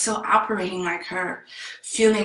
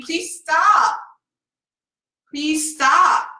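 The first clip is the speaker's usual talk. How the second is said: high, loud re-enacted begging; bawling plea; 9 semitones higher, 5 dB louder, much slower than usual. Bawling child outside the car begs arguing adults, desperate pleading. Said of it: "I'm bawling, and I'm saying".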